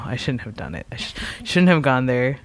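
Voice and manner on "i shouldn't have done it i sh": much softer whispered confession. Real, not acted. Admitting to friends he went too far, whispered. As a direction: drop to a guilty whisper. Mock regret about pasta joke.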